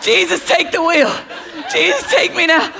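high-pitched mock desperation; comic, pleading daughter voice; exhausted daughter. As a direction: mimic a worn-out kid praying for rescue. Voicing daughter's comic exhausted pleas.

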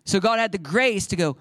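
ordinary talk, nothing marked

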